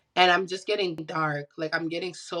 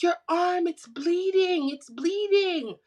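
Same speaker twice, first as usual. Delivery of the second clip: high, drawn-out retold cry of alarm; 10 semitones higher, 3 dB louder, slower than usual. Friend warns her she is bleeding, mock alarm. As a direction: stretch it out like a panicking friend.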